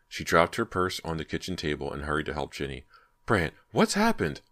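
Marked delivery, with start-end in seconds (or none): 3.3-4.4 alarmed. A narrator's reading. Mother rushing in alarmed.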